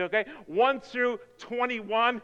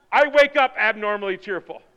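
usual speaking voice

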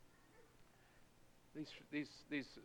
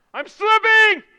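high loud cry of panic; desperate cry; 22 dB louder, slower than usual. Acted. Psalmist crying out to God as he slips, desperate cry. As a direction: shout it like you're losing your grip. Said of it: "with an exclamation point".

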